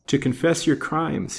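usual speaking voice

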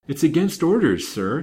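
sullen refusal, starting high and falling; mildly sullen protest. A narrator's reading. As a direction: refuse like a sulky maid.

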